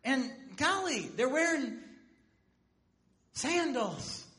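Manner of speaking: ordinary delivery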